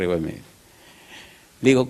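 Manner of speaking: ordinary delivery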